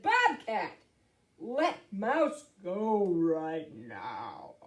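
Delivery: big bear standing his ground; big commanding bear. Acted. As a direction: draw yourself up and order it slowly. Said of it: "as big as he could get".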